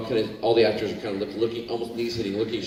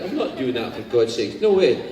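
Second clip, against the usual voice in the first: put-on Scottish accent; 4 semitones higher than usual. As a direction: laugh through a Scottish accent. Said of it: "My dad has a thick Scottish accent".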